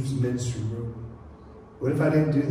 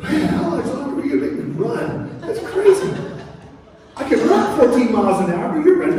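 high-pitched, hammed-up protest; comic exasperated complaint; 9 semitones higher, 4 dB louder than usual. Preacher jokes as Philip running by the chariot, comic exasperated complaint. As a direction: gripe as if made to run alongside.

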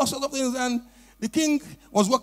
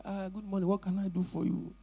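drowsy greeting; 4 semitones lower, 12 dB quieter than usual. Acted. Sleepy man answering door.

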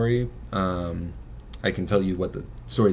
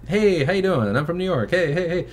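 high, hammed-up New York accent; imitating a chatty New York lady, pitched way up; 9 semitones higher than usual. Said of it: "like, a thick New York accent".

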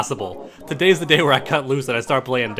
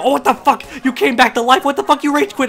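higher, louder shocked yelling; outraged disbelief; 8 semitones higher, 4 dB louder than usual. Real, not acted. Opponent respawns unexpectedly.

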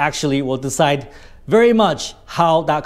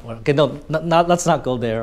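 normal talking voice

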